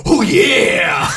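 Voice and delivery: high loud wrestler growl; Macho Man voice. Retold or imitated. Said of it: "this sweet voice comes out".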